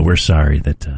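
hushed funeral director voice; low, somber undertaker tone; mortician voice. Retold or imitated. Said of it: "help having mortician voice".

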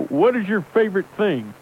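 lightly put-on folksy trucker voice; Microphone Johnson character. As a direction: play it as a gruff hardliner caller. Holds a put-on character throughout.

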